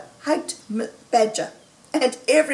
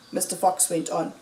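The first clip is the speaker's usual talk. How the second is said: ordinary delivery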